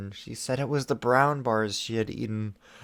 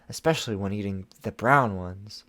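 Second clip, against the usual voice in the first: ordinary delivery, nothing marked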